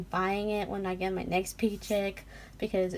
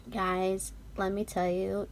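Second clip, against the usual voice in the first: usual speaking voice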